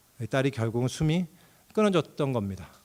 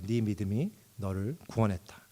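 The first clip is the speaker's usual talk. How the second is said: softer, lower quoted line of Jesus; gentle Jesus voice; 5 semitones lower, 7 dB quieter than usual. Acted. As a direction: say it gently as Jesus blessing her. Jesus speaking, quoted in sermon. (부드럽게 낮춘 예수님 목소리)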